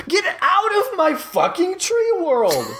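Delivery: high shouting monster voice; angry blob shouting. Acted. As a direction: bellow at the intruder as the blob. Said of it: "it says".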